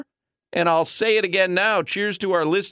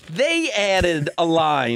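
dramatic commercial read; much slower than usual. Shown as a demonstration. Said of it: "I gotta find the copy".

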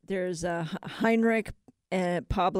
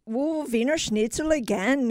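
higher-pitched, put-on German accent; bad German accent; 5 semitones higher than usual. German worker finding schnitzel again, bad German accent. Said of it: "that's a bad German accent".

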